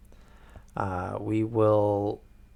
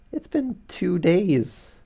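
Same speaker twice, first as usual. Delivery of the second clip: high, slow disbelief at the hasty engagement; incredulous; 9 semitones higher, 6 dB louder, slower than usual. Real, not acted. Reader reacting to Watson's sudden engagement, high and incredulous. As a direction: drag it out, can't believe it.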